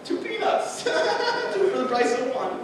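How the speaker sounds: high squeaky glee with a giggle; giddy delight. Retold or imitated. Finding an extra peanut, high giddy squeal. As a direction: squeal over the bonus peanut. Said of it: "You're just like".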